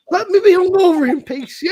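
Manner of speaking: laughing through the words. Retold or imitated. Retelling to Michelle how he begged his friend for peace, laughing.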